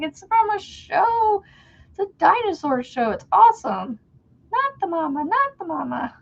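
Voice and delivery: high, lively voice that gushes about the show; excited explaining. Retold or imitated. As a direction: gush to the kid about the dinosaur show. Retelling own reply to kid.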